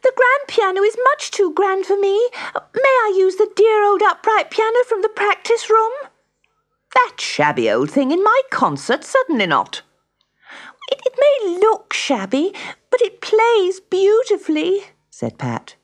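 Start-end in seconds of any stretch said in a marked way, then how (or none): 0.0-5.9 put-on very high child's voice; urgent pleading girl. A narrator's reading. Pat runs to find her teacher, high urgent plea. 6.9-9.8 high scornful refusal; indignant teacher. A narrator's reading. Music teacher refusing the shabby piano for her concert, indignant. 10.9-14.8 put-on very high, louder child's protest; protesting girl. A narrator's reading. Pat defending the old piano to her teacher, protesting high.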